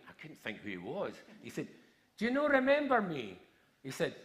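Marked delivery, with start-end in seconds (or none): 2.2-3.4 high, loud imitated call; acting out a young man shouting. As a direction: call out across a queue, voice pitched up. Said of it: "he shouted in a, a really loud voice".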